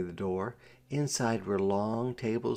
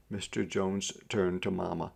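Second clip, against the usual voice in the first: ordinary delivery, nothing marked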